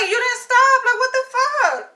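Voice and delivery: high, loud indignant protest; reenacted angry retort. Pedestrian retells scolding the driver for not stopping, high indignant yell.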